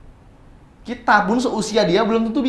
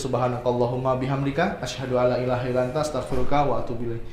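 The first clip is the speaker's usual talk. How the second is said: quiet, steady recited prayer; closing prayer; 5 semitones lower, 5 dB quieter, faster than usual. Acted. (membaca doa penutup dengan pelan)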